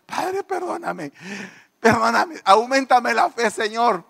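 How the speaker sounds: high, drawn-out mock pleading; pleading prayer. Preacher re-enacting his prayer over the small flock, high pleading. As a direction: beg forgiveness in a high wail. Acted desperate prayer after counting sheep.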